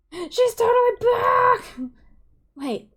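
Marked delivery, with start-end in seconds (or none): 0.1-1.6 much higher, much louder shriek of news; excited shout. Acted. Hyper friend shouting that May is back, loud high shout. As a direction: scream the news with glee.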